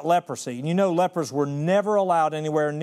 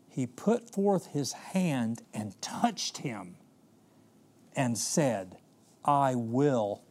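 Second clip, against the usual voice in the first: gentle, hushed; 4 semitones lower, much slower than usual. Acted. Jesus heals the leper gently.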